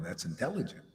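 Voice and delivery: usual speaking voice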